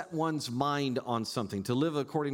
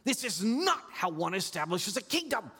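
higher indignant protest, lightly acted; Peter's rebuke; 9 semitones higher than usual. Peter rebuking Jesus about suffering, indignant and higher. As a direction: Peter scolding his master, mildly voiced. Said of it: "He rebukes Jesus and he says".

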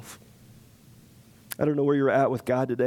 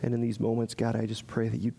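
hushed prayer to close the service; closing prayer; 3 semitones lower than usual. Real, not acted. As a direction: pray gently over the congregation. Pastor leads closing prayer.

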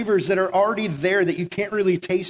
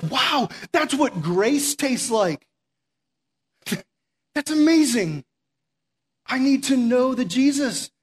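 amazed wonder; 4 semitones higher, slower than usual. Retold or imitated. Voicing an amazed outsider.

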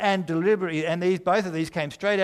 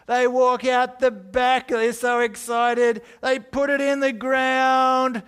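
higher, louder, slow thrilled narration; excited; 8 semitones higher, 6 dB louder, slower than usual. Acted. Preacher to congregation, voicing the couple's loud delight. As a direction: build up their giddy excitement.

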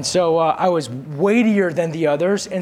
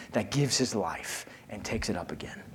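whisper; 8 semitones lower, 12 dB quieter than usual. Real, not acted. Sermon line sinks to whisper.

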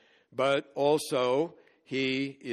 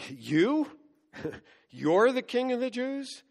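acted disbelief, scoffing laugh and voice jumping much higher; incredulous; 11 semitones higher than usual. Pilate questioning whether Jesus is really a king, incredulous. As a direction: ask it high-pitched, with a laugh of disbelief at YOU. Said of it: "it's probably an incredulous question".

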